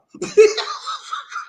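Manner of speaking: bursts into a high, airy laugh; cracking up at his own bit; high breathy laugh. Real, not acted. Laughs after mock wife line.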